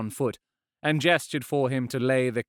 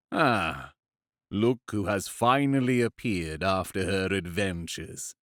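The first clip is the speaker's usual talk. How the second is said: lower, mocking villain voice; sneering villain; 3 semitones lower than usual. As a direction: greet her with cold mockery. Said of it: "never forget that voice".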